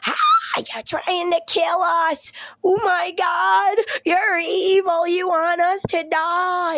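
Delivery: high, loud, drawn-out mock panic; hammed-up shrieking son; imitated screaming child. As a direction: wail it like a terrified kid accusing a grown-up. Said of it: "My son starts screaming".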